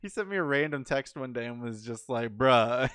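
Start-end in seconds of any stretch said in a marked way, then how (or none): 2.4-2.9 a punchy, much louder bruh. Retold or imitated. Retelling a friend's 3 a.m. text, a loud bruh. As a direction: blurt it out like a friend's exasperated text. Mark's late-night text retold.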